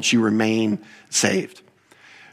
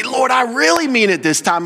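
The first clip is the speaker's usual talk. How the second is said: high, insistent mock prayer; earnest pleading; 11 semitones higher than usual. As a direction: plead it high, like a repentant believer swearing sincerity. Acting a repeated promise to God.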